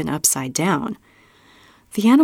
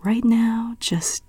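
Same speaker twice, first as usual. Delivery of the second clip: hushed, whispered calm; 4 semitones higher, 4 dB quieter, slower than usual. A narrator's reading. Roberta calming her frightened sister in a soft whisper. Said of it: "speak to her very calmly".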